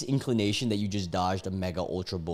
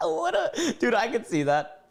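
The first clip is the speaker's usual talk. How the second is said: squeaky disbelief; 13 semitones higher than usual. Real, not acted. Laughing at imagined Chili's yeller.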